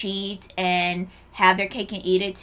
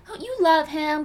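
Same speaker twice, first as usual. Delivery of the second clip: high, girly mock hype voice, much higher; younger self egging on; 9 semitones higher than usual. Her younger self cheering a friend to try again, high and giddy. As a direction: gush like a giddy twenty-something girlfriend. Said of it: "I probably would've been like".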